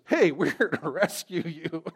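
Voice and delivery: eager movie-hero impression with a laugh; hammed-up, excitable young hero; mock heroic announcement. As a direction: burst in to announce the rescue. Acting out Luke bursting into cell.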